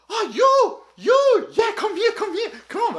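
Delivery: high, loud, laughing call; playful bar girl. Retold or imitated. Bar girl calling to passing tourists, high laughing beckon. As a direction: beckon them over like a giddy bar girl. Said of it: "and she goes".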